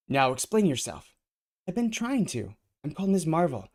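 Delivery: high, loud insistent voice. Acted. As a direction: insist loudly. Heated exchange.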